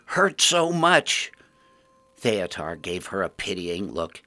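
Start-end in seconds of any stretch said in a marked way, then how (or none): 0.0-1.3 much higher and louder, slow at the end; crazed rhyming cry, drawn out; mad ghoul crying out. Acted. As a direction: wail the rhyme like a broken soul. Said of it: "cried out".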